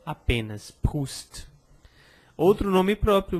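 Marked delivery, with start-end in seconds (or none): 0.7-1.5 "Proust" ending on a bare t, no vowel; T muet sans voyelle. Shown as a demonstration. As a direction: stop "Proust" right on the t. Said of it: "um som vocálico em seguida".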